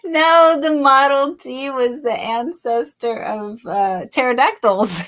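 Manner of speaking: laughing through a joke; giddy laughing. Real, not acted. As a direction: giggle through the silly line.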